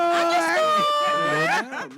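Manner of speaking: big drawn-out shout of no doubt. Real, not acted. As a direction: belt out the catchphrase. Host's catchphrase shout.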